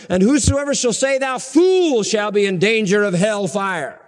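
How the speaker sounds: solemn scripture read high and strong; preached scripture. Acted. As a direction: proclaim the verse from the pulpit. Reads scripture with raised voice.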